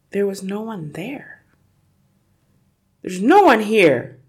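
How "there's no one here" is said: loud, higher announcement of relief; relieved father. A narrator's reading. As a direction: let the relief out loudly. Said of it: "the father said, greatly relieved".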